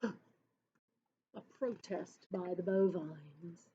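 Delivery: gasp, then say it hushed; gasping aside. Acted. Teacher reacting with a hushed, gasping aside.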